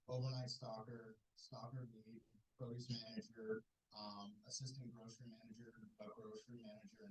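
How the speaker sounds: very faint, fading bad connection; radio breaking up. Real, not acted. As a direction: voice dropping away on the line. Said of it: "We can't hear you still".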